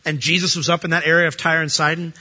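usual speaking voice

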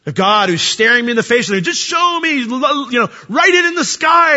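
high, loud demanding plea; mock impatient demand, pitched high; pleading demand. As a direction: beg God to write it in the sky.

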